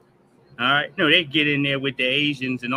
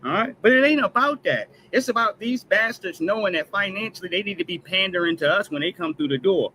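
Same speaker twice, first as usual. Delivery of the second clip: normal talking voice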